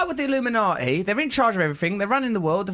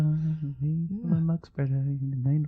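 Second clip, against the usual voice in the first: whispery; 4 semitones lower than usual. Acted. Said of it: "it's a bit too whispery".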